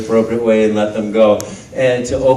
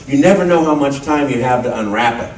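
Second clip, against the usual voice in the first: ordinary delivery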